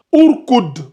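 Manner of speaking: "urkud" lu avec un ou initial; lecture avec ou initial. Shown as a demonstration. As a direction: prononce "urkud" en commençant par le son ou. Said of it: "on va lire ici". ("urkud" articulé à l'arabe, ou au début)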